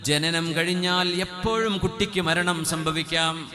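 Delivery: high, loud, slow preaching climax; fiery sermon peak; full-force preaching. Acted. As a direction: drive the point home from the pulpit. Preacher stresses death can come anytime.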